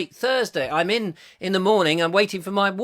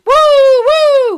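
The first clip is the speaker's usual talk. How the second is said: very high, loud "woo"; drawn-out high cheer; cheering whoop; 14 dB louder than usual. Real, not acted. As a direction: whoop in celebration, long and shrieking. Cheering the uploaded video.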